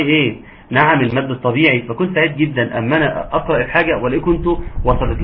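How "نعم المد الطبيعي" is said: ordinary delivery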